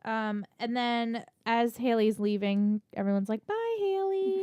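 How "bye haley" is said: singsong goodbye. Retold or imitated. Everyone calling goodbye to Haley.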